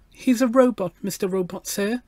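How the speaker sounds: put-on high, earnest little girl; treble child voice. A narrator's reading. Gloria describes her robot friend to the machine, earnest treble. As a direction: explain it in a small, high, polite voice. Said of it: "she raised her treble voice".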